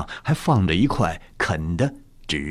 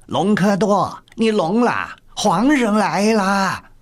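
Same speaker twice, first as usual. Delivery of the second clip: high, loud, drawn-out announcement; loud eunuch's call; 13 semitones higher, 4 dB louder, slower than usual. A narrator's reading. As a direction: call out sharply to rouse him, pitched very high. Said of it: "守护太监大声道". (太监高声喊叫)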